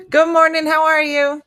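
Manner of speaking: high, loud, sing-out hello; called-out greeting. Real, not acted. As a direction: greet someone across the room. Greeting someone arriving.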